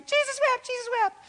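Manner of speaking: put-on high squeaky child's voice; imitating little kids. Dad imitating his small kids squealing a verse, high-pitched. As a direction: squeal it out like a tiny kid pinned down. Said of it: "They'd be".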